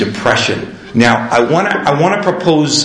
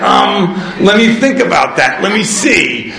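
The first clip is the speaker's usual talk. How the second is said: higher-pitched, put-on pondering; mock pondering; 7 semitones higher than usual. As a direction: pretend to think it over. Acted mock pondering of sponsor's question.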